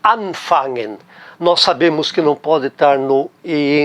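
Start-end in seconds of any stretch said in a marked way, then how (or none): 0.0-1.0 anfangen, weight on the prefix an; stress on AN. Shown as a demonstration. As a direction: lean on AN in anfangen. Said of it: "a sílaba tônica tá no an".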